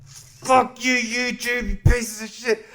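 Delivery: sudden high-pitched rant at YouTube; angry outburst. Real, not acted. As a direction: blurt out your fury at the platform. Ranting at YouTube after a pause.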